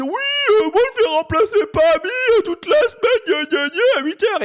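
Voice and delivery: high, teasing imitation of a braggart; mocking high voice. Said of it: "Il disait".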